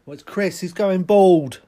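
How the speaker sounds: loud, high shocked yell; shocked shout across the room. Acted. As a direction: blurt it out at full volume. Acted shout about the bald remark.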